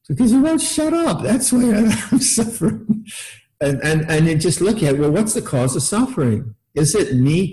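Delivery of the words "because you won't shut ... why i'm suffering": high, exasperated grumble breaking into a laugh; exasperated inner retort. Retold or imitated. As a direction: snap back at the teacher in your head, half laughing. Monk's inner retort to teacher.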